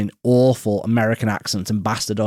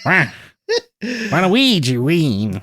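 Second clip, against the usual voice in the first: slow, high Waluigi voice; Waluigi impression; 7 semitones higher, slower than usual. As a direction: stretch it out like Waluigi. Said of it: "I can hear Waluigi now".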